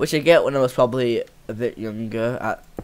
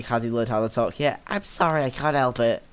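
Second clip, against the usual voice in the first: ordinary delivery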